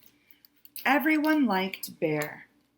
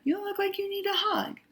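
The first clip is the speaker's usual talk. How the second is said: Bear's sweet voice; 11 semitones higher, faster than usual. Acted. Bear comforting Skunk in a high sweet voice. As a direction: coo it gently with pity.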